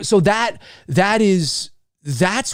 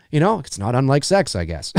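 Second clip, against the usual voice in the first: normal talking voice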